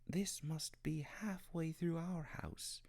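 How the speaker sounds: slow, breathless whisper in the dark; creeping through the attic, whispering. Acted. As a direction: hush it so nobody hears. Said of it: "whispered to one another".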